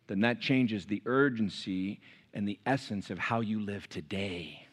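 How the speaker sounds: low, soft, slowing to a near-hush; hushed solemnity. Real, not acted. Preacher lowers voice for weight.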